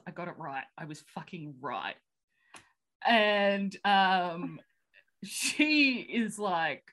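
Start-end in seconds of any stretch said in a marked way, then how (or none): 0.1-2.0 low, quiet sigh of relief; relieved. Retold or imitated. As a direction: say it softly and low, as if the danger has just passed. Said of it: "breathes a sigh of relief".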